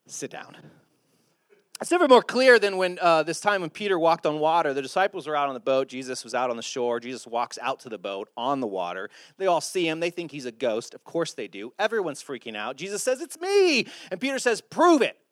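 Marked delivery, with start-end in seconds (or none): 0.1-0.6 whispered, much softer aside; hushed reprimand. Acted. To an overly bold friend, hushed whispered reprimand. 13.2-13.9 very high, louder sing-out; calling out. Acted. Jesus to freaked-out disciples in the boat, high call. 14.6-15.2 loud, high dare; bold challenge. Acted. Disciple in the boat daring Jesus, loud high challenge.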